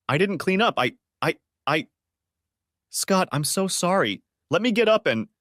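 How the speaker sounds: high, panicked and pleading; a wife terrified of her husband's return; frantic apology. A narrator's reading. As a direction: stammer it out in a rush of fear.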